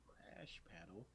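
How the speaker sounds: slow, hushed whisper; barely voiced, drawn-out echo; whispered question. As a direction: whisper it back in wonder. Retold puzzled reply, whispered.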